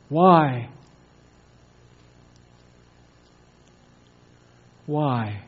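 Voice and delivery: quiet echo of a retold cry; hushed questioning. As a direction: let the question sink almost to nothing. Preacher dwells on Jesus' forsaken question.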